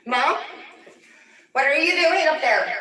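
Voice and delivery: loud, high call; calling up to mom. Retold or imitated. Calling up to mom upstairs, loud and high. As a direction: shout it up the stairs. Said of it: "you're like".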